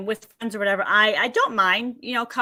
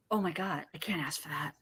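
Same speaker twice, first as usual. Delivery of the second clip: hushed, much softer, whispered fear; scared whisper; 7 semitones lower, 13 dB quieter than usual. Retold or imitated. Client whispering her fear to her coach. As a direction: whisper it like you dare not ask. Client frightened to ask husband.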